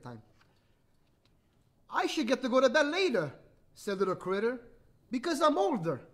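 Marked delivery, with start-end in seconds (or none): 1.9-3.3 put-on higher, louder child in a story; protesting boy character. 5.1-6.0 put-on higher, louder child in a story; protesting boy character.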